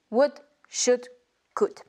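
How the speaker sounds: "would, should, could" with no L at all, like "wood, shood, cood"; silent L. Shown as a demonstration. Teacher shows learners the correct silent L. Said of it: "ne prononcez pas le L".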